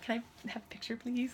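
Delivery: soft, small-voiced plea; retold timid request. Fan asking for a photo, softly pleading. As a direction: ask softly, like a shy fan. Fan asks shyly for a picture.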